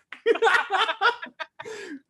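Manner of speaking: high, helpless burst of laughter; real laughing fit. Real, not acted. Retelling catching girlfriend cheating, real helpless laughter. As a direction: crack up mid-story. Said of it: "No, I'm laughing now".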